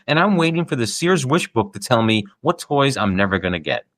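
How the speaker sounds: normal talking voice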